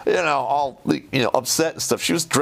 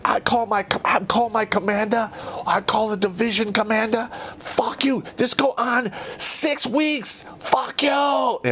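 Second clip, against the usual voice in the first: broken-English rant; 9 semitones higher than usual. Retold or imitated. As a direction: rant like the foreign sergeant major. Sergeant major's broken English rant.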